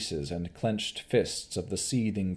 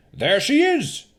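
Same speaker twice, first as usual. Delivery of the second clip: pointing baron; 8 dB louder than usual. Acted. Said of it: "the Baron said".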